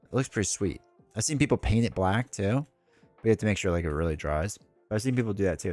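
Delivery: usual speaking voice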